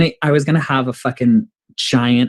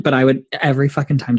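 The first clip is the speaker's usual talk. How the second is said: normal talking voice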